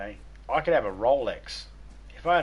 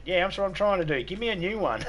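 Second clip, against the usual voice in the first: higher, pleading mock complaint; hammed-up parent asking for a new baby; mock complaining parent; 7 semitones higher than usual. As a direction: whine like a parent returning a faulty baby. Parent answering wife.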